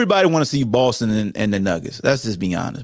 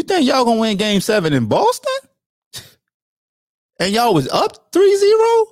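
incredulous; 10 semitones higher than usual. Real, not acted. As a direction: stretch it out high in disbelief. Mocking Miami fans.

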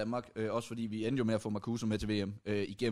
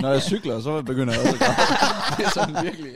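loud, breaking into laughter; laughing admission; 3 semitones higher, 8 dB louder than usual. Real, not acted. Friend admits to crying when cycling, laughing loudly. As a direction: blurt it out louder while laughing.

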